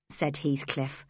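plain reading voice

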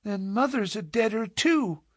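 high-voiced little girl's cry of grief; tearful child. A narrator's reading. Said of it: "sobbing bitterly".

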